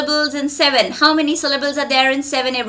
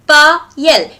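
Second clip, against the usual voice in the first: PA, YEL as two separate beats, PA louder; broken into syllables; 6 dB louder than usual. Shown as a demonstration. Teacher counts syllables in a student's name, Pa - yel split apart. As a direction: say Pa, yel with a gap between syllables. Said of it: "so two syllables".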